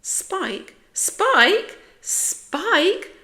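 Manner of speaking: aghast, high-pitched repeated question; spluttering disbelief. A narrator's reading. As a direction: splutter the name in mounting alarm. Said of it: "spluttered Splat".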